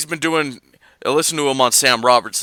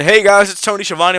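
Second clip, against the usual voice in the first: higher, louder announcer hello; Tony Schiavone greeting; 9 semitones higher, 7 dB louder than usual. Retold or imitated. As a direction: belt it out like a cheery old wrestling host. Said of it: "but hearing".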